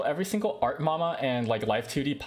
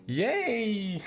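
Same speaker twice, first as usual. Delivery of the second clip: high bright cheer of joy; excited cheer; 9 semitones higher than usual. Real, not acted. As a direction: cheer with delight. Cheering after applause intro.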